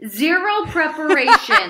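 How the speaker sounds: slow laughing punchline. Real, not acted.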